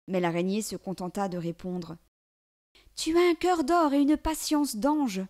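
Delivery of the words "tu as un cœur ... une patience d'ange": high, louder creature voice; a thankful spider, pitched up; talking spider. A narrator's reading. Spider answering the girl, high creature voice.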